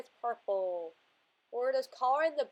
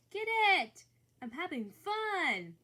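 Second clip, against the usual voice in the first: excited cheering; 6 semitones higher than usual. Acted. Voicing the drawn plant cheering.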